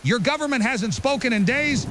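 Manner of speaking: ordinary delivery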